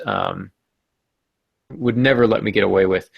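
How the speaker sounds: ordinary talk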